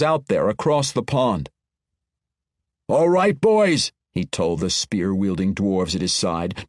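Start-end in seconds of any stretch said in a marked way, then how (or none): 2.9-3.9 a rallying call in a raised, Scottish-tinged dwarf brogue; rally the boys; gruff dwarf commander. Acted. Dwarf leader rallying his troops.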